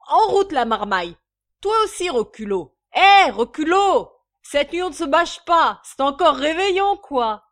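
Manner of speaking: loud, high rallying call; enthusiastic shouting. A narrator's reading. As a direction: holler to the brood like a merry drunk. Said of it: "s'écria J B enthousiasmé". (braillement enthousiaste)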